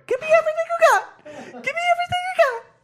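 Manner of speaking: very high, loud squealed puppet voice; Elmo. Acted. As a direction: squeal it like an excited little puppet. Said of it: "hooking me up with Elmo".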